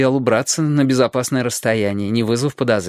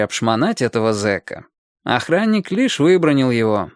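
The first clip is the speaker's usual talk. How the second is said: plain reading voice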